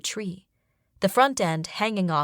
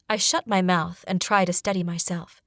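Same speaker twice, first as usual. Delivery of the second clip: ordinary delivery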